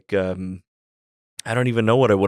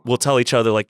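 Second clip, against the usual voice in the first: ordinary delivery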